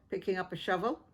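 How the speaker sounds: ordinary delivery, nothing marked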